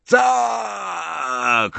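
long, high victory cry; stretched, exultant shout; triumphant roar. A narrator's reading. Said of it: "ryknął Harry".

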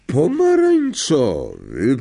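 high, drawn-out incredulous question; scornful disbelief. A narrator's reading. Professor repeats colour of pupil's botched potion, scornfully. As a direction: stretch the word out in contempt.

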